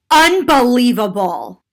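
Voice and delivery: loud, slow mock exasperation; imitated outrage. Woman scoffs at pre-boarding passenger, imitated loud outrage. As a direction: draw it out like an affronted passenger. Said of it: "And she said".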